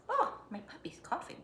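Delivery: quick hushed whisper; whispered aside. Real, not acted. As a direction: drop to a breathy whisper for an aside. Aside about coughing puppy.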